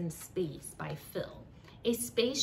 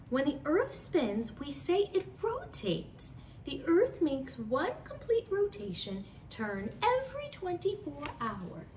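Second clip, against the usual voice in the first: teacher voice; 8 semitones higher than usual. Acted. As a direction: lecture the kids brightly. Teacher Frizzle explaining to class.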